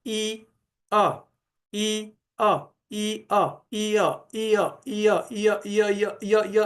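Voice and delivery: ई अ repeated, gaps shrinking until य; speeding up vowels. Shown as a demonstration. Teacher showing ई and अ merging into य, accelerating. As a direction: start slow, speed up till it blends. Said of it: "speed बढ़ाते जाएं".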